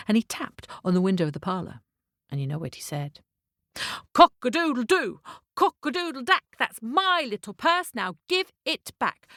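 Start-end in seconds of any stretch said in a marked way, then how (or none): 4.1-9.3 high rhythmic crow, slowing on the demand; crowing cockerel demand. Acted. Cockerel chasing the carriage for his purse, crowed demand. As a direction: chant it like an indignant cockerel, drawing out the ending. Cockerel crows at parlour window.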